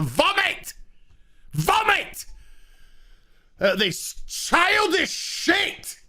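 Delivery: high, squealing exasperated outburst, slow on the insult; shrill mock rant. Reviewer disgusted by the show, high squealing rant. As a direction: squeal it like a fed-up diva. Ranting about childish scene.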